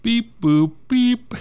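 high, bright computer beeps; robot beeping. Pretend. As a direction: beep it out like a little machine. Random generator making its noise.

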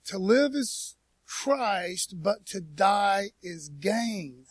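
very slow, each word weighed; quoting Paul very slowly and carefully; slow solemn quote. Shown as a demonstration. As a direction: recite it deliberately, like scripture. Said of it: "I hope I don't butcher this".

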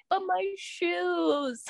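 high, slow, whining put-on voice with a laugh; whiny wail. Lamenting her new sneakers to the sick friend, high whine. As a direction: wail over the new shoes like a sulking kid. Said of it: "was like".

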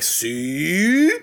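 drawn-out knowing "see" swinging upward; mock vindicated, stretch it out rising; smug imitation of old-school Italians; imitated I-told-you-so.

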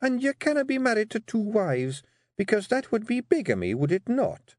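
high, sweet Scottish voice with menace; dangerously sweet. Acted. Wife warns Rob of bigamy, dangerously sweet. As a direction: coo the threat as a question. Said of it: "her voice dangerously sweet".

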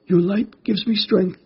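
very high-pitched small creature voice; spider character voice. Acted. Stumbling spider answers the glowing mantis, high creature voice.

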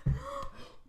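sharp gasp of fright; startled gasp. Real, not acted. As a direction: gasp at the spider. Sees a spider.

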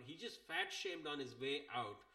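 usual speaking voice

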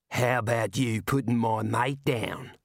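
slow, tough Aussie voice; tough Aussie dwarf. Acted. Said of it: "said a voice".

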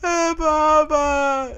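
high, slow, drawn-out whine; loud mimicked baby wail; wailing toddler imitation. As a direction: bawl for a bottle like a toddler. Imitates crying toddler wanting bottle.

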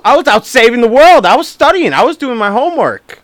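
high, loud, hammed-up protest; over-the-top wounded innocence; mock indignation. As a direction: play the outraged teen defending himself to dad. Acting indignant teenage denial to dad.

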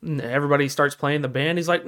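normal talking voice

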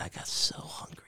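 a hushed whisper. Real, not acted. Whispering to his friend about the sudden munchies. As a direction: drop to a whisper, like sharing a secret.

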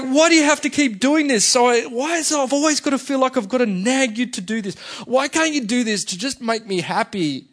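high, loud exasperated rant, imitating a wife; frustrated wife. Wife nagging husband to do his chores, frustrated. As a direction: pile up the complaints like a fed-up wife. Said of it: "is so frustrated and she's like".

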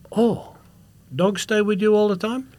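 high, wondering broken-English question; imitated foreign security guard. Guard asking traveller about the dog, high wonder. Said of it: "big, he looked like a Moroccan, massive guy".

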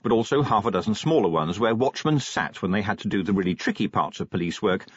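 ordinary delivery, nothing marked

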